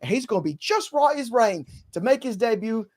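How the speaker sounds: put-on high-pitched plucky Dickens urchin, British accent; Tiny Tim. Host gives injury news as a Dickens character, Tiny Tim impression. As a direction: pipe up like a British storybook child. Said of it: "Tiny Tim Charles Dickens".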